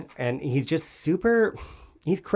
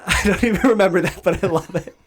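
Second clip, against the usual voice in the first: laughing; 6 semitones higher, 4 dB louder than usual. Real, not acted. Laughing at a funny quote.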